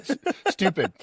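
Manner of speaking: ordinary delivery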